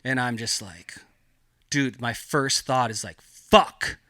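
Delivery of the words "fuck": sudden louder, higher expletive; retold alarmed curse. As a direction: blurt the curse out, re-enacting the shock. Retold first thought at gunpoint.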